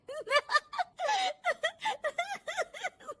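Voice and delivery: high giggling cackle, long and unrestrained; daughter-in-law laughing helplessly at TV; loud laughing. Acted. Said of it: "बहू के जोर जोर से हंसने की आवाज".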